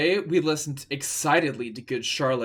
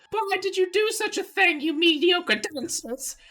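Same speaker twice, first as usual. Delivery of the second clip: McGonagall. Acted. McGonagall scolds the pupils, in a high indignant voice. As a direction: screech like a prim old teacher.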